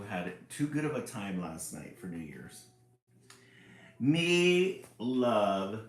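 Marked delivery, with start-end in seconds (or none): 4.0-5.8 loud, simple-minded booming voice; silly monster-like voice. Acted. Board book announcing fairy tales, booming. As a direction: boom it out gleefully. ABC book character speaks.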